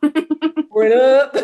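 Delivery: loud, drawn-out catchphrase echo; silly slow echo of a catchphrase; vocal stim. Acted. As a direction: stretch it out loudly and playfully. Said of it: "I got a new vocal stim".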